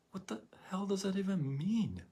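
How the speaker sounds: high, scornful disbelief; mock outrage at a silly phrase; incredulous mock. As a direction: scoff at the phrase.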